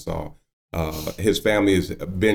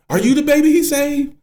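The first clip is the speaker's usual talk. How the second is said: very high, louder incredulous question; astonished older woman; 6 dB louder than usual. Retold or imitated. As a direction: imitate her mounting amazement. Woman realizing who is calling.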